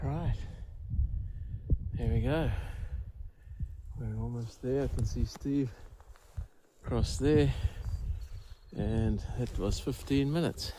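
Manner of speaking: hushed, quiet lakeside voice; fishing whisper. Real, not acted. Quiet lakeside talk near fish.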